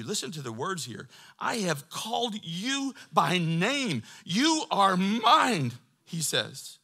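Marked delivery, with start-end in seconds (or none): 1.4-5.7 slow, loud, high scripture quote; ringing pulpit proclamation; emphatic proclamation. Retold or imitated. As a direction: draw out each word as God's declaration. Preacher proclaims God's words in scripture.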